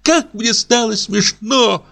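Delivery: high, slowed squeal of delight. A narrator's reading. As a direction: squeal with a child's glee. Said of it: "сказала Саша в восторге".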